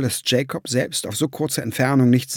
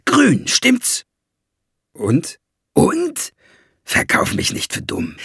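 raised, swooping, taunting voice; jeering dwarf, breaking into higher pitch; scheming dwarf; 6 semitones higher than usual. A narrator's reading. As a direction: press him with scornful excitement. Dwarf excitedly confronts Jacob.

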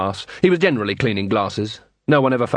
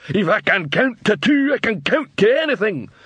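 troll voice; 10 semitones higher than usual. A narrator's reading. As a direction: echo it back like a dim troll. Troll repeats.

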